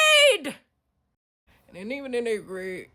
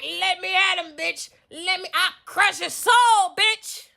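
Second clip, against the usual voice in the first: high, loud alter ego straining to get loose; pitched-up, explosive inner demon; raging inner voice; 8 semitones higher, 11 dB louder, slower than usual. Acted. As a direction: yell like a fighter being held back. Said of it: "there's a bitch in my head".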